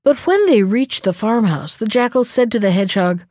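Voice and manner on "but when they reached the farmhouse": plain reading voice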